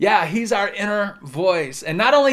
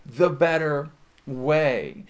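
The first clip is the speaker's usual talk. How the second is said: gentle God; much slower than usual. Acted. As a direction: invite them kindly, very slow. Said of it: "he'll even say".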